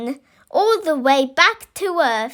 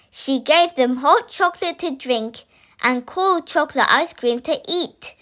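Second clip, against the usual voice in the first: plain reading voice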